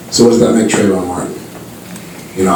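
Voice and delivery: ordinary talk, nothing marked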